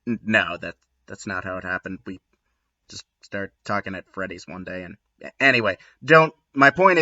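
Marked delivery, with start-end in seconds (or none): 0.1-5.0 dropped to a much softer mumbled aside; hushed confession, much softer; quiet aside. Real, not acted. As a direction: tuck it away under your breath. Quiet aside correcting joke.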